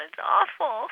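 crying as it spills out; tearful. Acted. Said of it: "I'm very emotional".

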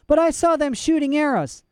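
put-on high, distressed child's voice; upset child. A narrator's reading. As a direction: insist desperately. Girl upset.

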